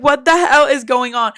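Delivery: loud, excited and stunned; excited disbelief. Retold or imitated. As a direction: shout it with a friend.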